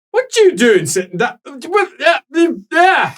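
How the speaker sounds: put-on accent slips as he shouts, Nordlander; shouting, Nordlander accent breaking through; Nordlander accent slipping. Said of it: "his accent almost entirely slips".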